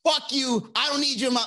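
loud, high, heated rant at a partner; mock furious tantrum, voice cracking high; angry outburst. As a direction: blow up and lose your cool. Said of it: "But if I'm angry".

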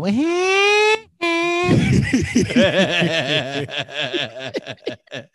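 big real laughter; long laugh. Real, not acted. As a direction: burst into laughter. Long laugh at song choice.

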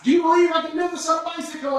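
loud, high call across the falls; yelling to the crowd. Retold or imitated. Tightrope walker shouting to the crowd below, loud and high. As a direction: shout it so thousands can hear. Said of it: "he would ask".

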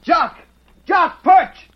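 high, loud urgent calls; short commanding shouts, pitched high; calling a falcon. Acted. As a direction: call out sharply to a bird overhead. Boy calls his falcon down.

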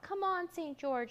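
high, urging cheer; cheering shout. A narrator's reading. Jack cheers Saint George on, high shout. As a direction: shout encouragement at a high pitch. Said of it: "shouted Jack".

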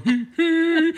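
high, squeaky teasing giggle; mock giggle. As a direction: giggle like a gleeful getaway driver. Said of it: "he's going".

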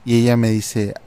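ordinary delivery, nothing marked

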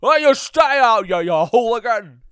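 loud, high character yell; scolding Jimmy, riled up. Pretend. Jimmy chases a hooligan out, mock scolding shout. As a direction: shout them off like a fussy old-movie character.

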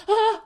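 high squealing ah; excited squeal. Retold or imitated. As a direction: squeal with laughing delight. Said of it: "just be like".

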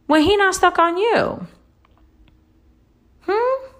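high rising challenge; sharp high prodding question; pointed. Real, not acted. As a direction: corner them with a high Hmm.

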